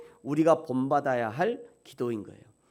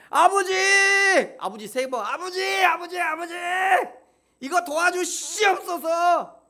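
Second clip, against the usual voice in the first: high, loud, overdone cries to father; hammed-up desperate shouting prayer; mock wailing prayer; 5 dB louder than usual. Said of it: "이렇게 기도하는 사람 있어요?". (과장되게 울부짖는 기도)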